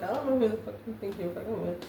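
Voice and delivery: ordinary talk, nothing marked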